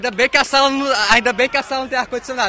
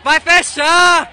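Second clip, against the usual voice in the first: shouting; 4 semitones higher, 6 dB louder, much slower than usual. Real, not acted. Gate closing.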